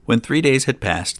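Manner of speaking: plain reading voice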